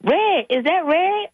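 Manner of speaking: high, slow, excited question; excited disbelief. Retold or imitated. Grandma spots the lost lizard, high slow excitement. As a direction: stretch it out in hopeful disbelief.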